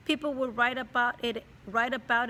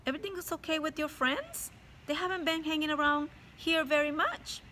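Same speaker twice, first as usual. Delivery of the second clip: higher, quicker mom voice; caring mom; 6 semitones higher than usual. Acted. Mom asks about Nick's friends, higher and anxious. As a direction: press gently like a worried mother.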